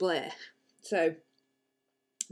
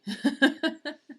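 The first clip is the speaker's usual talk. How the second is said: short amused laugh; a quick little laugh at herself; light laugh; 8 semitones higher, 3 dB louder than usual. Real, not acted. Laughs at own remark.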